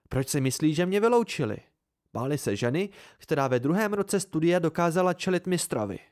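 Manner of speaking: high-pitched voice for a defiant woman; mocking praise turning into a proud challenge; taunting Devi. A narrator's reading. Devi boasting to her opponent after laughing, taunting.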